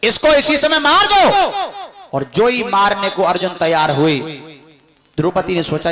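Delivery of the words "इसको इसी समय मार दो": angry demand. Acted. Draupadi demands the killing.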